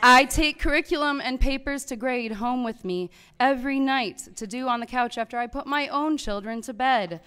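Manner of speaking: normal talking voice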